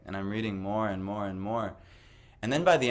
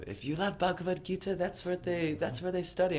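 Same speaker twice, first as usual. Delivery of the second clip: imitating a man's much higher squeaky voice; playful impression of a friendly shopkeeper, far higher; high-pitched voice; 10 semitones higher, 3 dB quieter than usual. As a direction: jump way up into a thin high voice to imitate him. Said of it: "he had a really, like, high-pitched voice".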